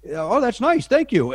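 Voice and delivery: high, loud, gushing thanks; overly gracious. Retold or imitated. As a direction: gush politely while panicking inside. Fake-gracious reply to Topher.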